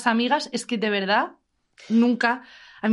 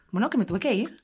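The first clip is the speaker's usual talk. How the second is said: ordinary talk, nothing marked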